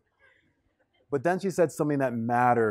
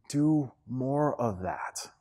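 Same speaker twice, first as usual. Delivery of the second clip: slow, softer, word by word; slow emphasis; 2 semitones lower, 7 dB quieter, much slower than usual. Real, not acted. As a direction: let each word sink in, slowly and quietly. Repeats advice slowly, softly.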